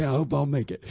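ordinary delivery

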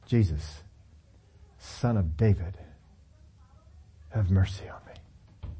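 soft, low, trailing to a whisper. Shown as a demonstration. Preacher contrasting a shout by murmuring the plea, whispered. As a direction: hush the cry down to a breath. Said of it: "shouting at the top of his lungs".

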